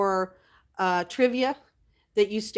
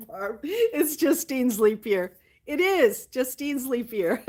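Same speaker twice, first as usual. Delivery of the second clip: laughing tease; 5 semitones higher, 3 dB louder, slower than usual. Real, not acted. Teasing chat about leap year.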